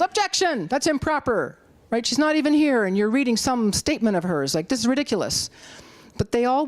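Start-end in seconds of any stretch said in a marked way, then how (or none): 0.0-1.6 high, sharp mock objection; mock yell. Speaker imitating defendants' lawyers with a mock objecting yell. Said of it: "the defendants could have yelled". 2.0-5.5 high, indignant mock protest; mock indignant lawyer. Speaker imitating defendants' lawyers, mock indignant complaint. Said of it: "the defendants could have yelled".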